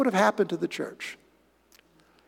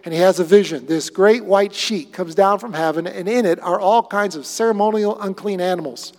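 usual speaking voice

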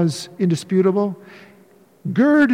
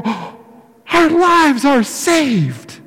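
mock disciples, very slow; 4 semitones higher, much slower than usual. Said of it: "And they go".

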